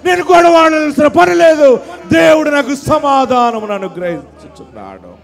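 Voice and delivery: half-shouted preacher's proclamation of freedom; loud triumphant retort delivered from the pulpit; defiant proclamation. Retold or imitated. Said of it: "అప్పుడు అంటాడు ఫరో".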